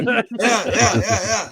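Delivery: high, laughing mock coaxing; sleep-talking friend. Retelling buddy flagging in his sleep, high laughing mimicry. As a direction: imitate a dreaming flagger waving cars on. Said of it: "He was in there".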